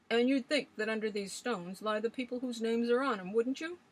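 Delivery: put-on old Swales ranting. A narrator's reading. Old man scoffing at legends to the young ladies, loud and gruff. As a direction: rail against ghost stories like a stubborn old sailor.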